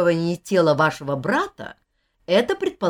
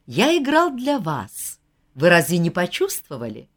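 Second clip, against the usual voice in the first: usual speaking voice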